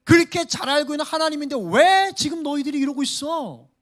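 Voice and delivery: loud, high, stinging reproach; sting them with the question; sharp rebuke. Acted. Isaiah sharply rebukes the exiles, loud and high. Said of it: "따끔하게 충고하고 있는 거예요".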